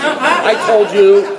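high, drawn-out insistence; frustrated retold comeback; exasperated protest. Retold argument with sponsor.

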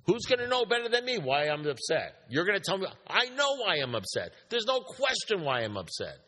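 high-pitched indignant rant; angry outburst. Retold or imitated. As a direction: re-enact an offended, heated protest, pitched well up. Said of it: "told him with passion 'cause I was angry".

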